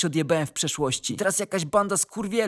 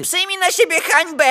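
very high, loud command; full-force, high-pitched condemnation; ritual shout; 11 semitones higher, 6 dB louder than usual. A narrator's reading. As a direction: yell it as a fanatical order. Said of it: "i krzyczy".

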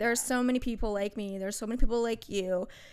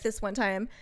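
usual speaking voice

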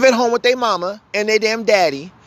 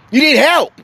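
higher and louder, insistent; emphatic; 6 semitones higher, 5 dB louder than usual. Real, not acted. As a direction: hammer the point home. Angry rant about counseling.

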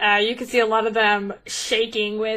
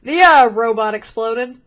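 blunt; 7 dB louder than usual. Acted. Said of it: "he just very bluntly says".

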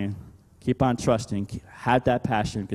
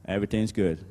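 usual speaking voice

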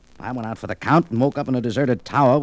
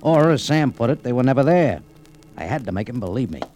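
noir story narration; 4 dB louder than usual. A narrator's reading. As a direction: let the vanishing boat sink in. Narrator reflects on Sam's words.

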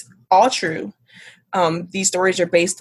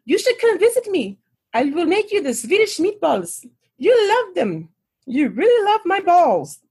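high-pitched tipsy Swedish lady; Swedish accent; 10 semitones higher than usual. Retold or imitated. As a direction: gush in a hammed-up Swedish accent. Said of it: "try to do a Swedish accent".

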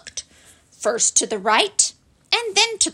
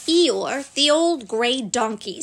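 ordinary delivery

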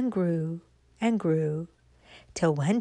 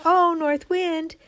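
acorn calling to the wind; 6 semitones higher, 4 dB louder than usual. Acted. Little acorn calling up to the north wind, pleading. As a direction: call up hopefully to the wind. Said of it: "she said".

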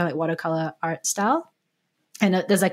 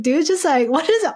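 higher-pitched imitation of a baffled guy; mock bafflement; 7 semitones higher than usual. Voicing the booth guy's reaction to her gushing, baffled. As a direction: act out his bewilderment at you.

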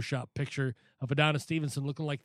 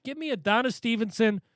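hyped up; 7 semitones higher, 4 dB louder than usual. Real, not acted. Hyping a dream grudge match.

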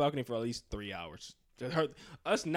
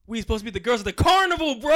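excited shout; 9 semitones higher, 14 dB louder, faster than usual. Retold or imitated. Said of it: "He goes".

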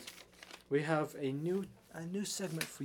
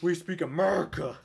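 louder, proud hammed-up declaration; mock patriotic proclamation; 3 semitones higher, 8 dB louder than usual. Hosts joking about America, loud proud declaration. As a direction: declare it like a flag-waver.

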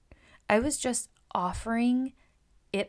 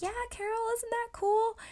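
much higher, bright and chirpy; playful high voice. Retold or imitated. Stylist answering client Carol, mock chirpy and high. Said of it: "And you're like".

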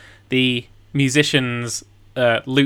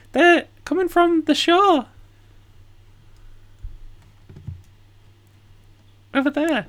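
put-on cabin boy voice. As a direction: point the way like a kid.

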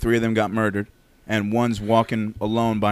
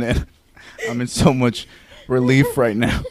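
usual speaking voice

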